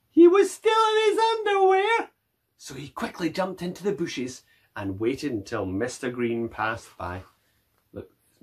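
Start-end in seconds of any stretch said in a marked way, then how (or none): 0.0-2.1 shocked realisation. A narrator's reading. Mac notices he is undressed.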